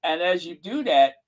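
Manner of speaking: ordinary talk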